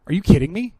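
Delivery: incredulous outburst at critics; loud, exasperated are you kidding me. Real, not acted.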